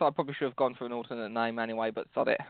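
normal talking voice

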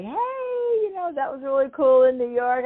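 high, bright mock-cheery fan voice; upbeat greeting. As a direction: greet her high and eager. Rusty greeting her, retold.

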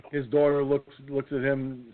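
ordinary talk, nothing marked

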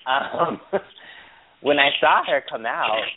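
ordinary talk

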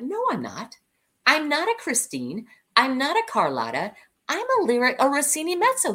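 high-pitched, insistent correcting; retold protest. Singer tells director she is wrong for Phantom, insistent protest. Protesting his casting suggestion.

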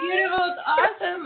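teary, voice breaking up high; real happy tears spilling through the words; choked up with grateful tears; crying. Real, not acted. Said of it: "I'm crying over here".

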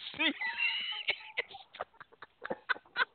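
breathless high laugh; high squeaky laugh. Real, not acted. Host laughing at his own punchline, squeaky laugh. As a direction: laugh at the joke.

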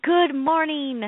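high, slow sing-song good morning; drawn-out greeting. Real, not acted. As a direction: stretch the greeting out brightly. Greeting back.

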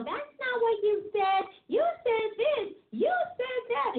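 high, slow, insistent little correction; know-it-all kid. Retold or imitated. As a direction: play the child who must have the last word. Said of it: "Had to always let somebody know".

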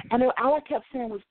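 ordinary talk

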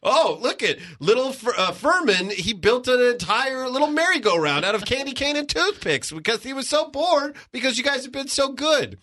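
high, gushing proud-parent voice. Acted. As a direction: imitate a parent bragging about a perfect kid. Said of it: "parents who are so good at everything where it's like".

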